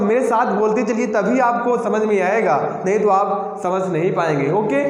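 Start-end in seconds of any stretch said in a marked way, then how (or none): none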